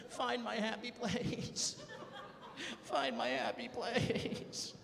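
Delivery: slow self-soothing chant; slow mantra to calm himself. Pretend. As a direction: breathe it out slowly. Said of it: "repeated calming mantra".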